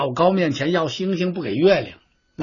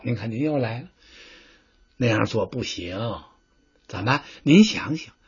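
much softer, low gentle objection; patient advisor; 6 semitones lower, 7 dB quieter than usual. Acted. Advisor gently reins in the general, low and soft. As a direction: talk him down quietly.

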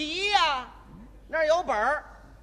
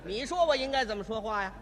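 sarcastic retort; 5 semitones lower, 5 dB quieter than usual. Acted. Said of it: "今天我就扮演这位售票员".

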